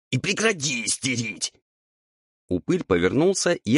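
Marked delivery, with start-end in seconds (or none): 0.1-1.5 high, softish raspy scolding; a touch of a hiss in a ghoul's rasp. A narrator's reading. Ghoul orders fussing devil to stop, hissing. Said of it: "зашипел на него упырь".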